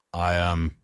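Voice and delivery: low, unsure start; hesitantly. Acted. Mike is asked about his driving, hesitant and unsure. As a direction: stall a little, caught off guard. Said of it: "I reply hesitantly".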